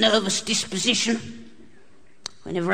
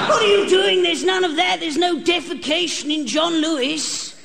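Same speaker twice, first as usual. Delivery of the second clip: high-pitched, outraged shop assistant; mock-appalled staff reprimand; acted telling-off; 7 semitones higher than usual. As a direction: scold a misbehaving customer at a high pitch.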